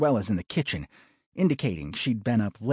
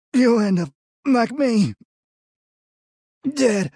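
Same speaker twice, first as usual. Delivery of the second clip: high, loud, strained final words; dying man's last gasp; 13 semitones higher, 8 dB louder than usual. A narrator's reading. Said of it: "barely able to get the words out".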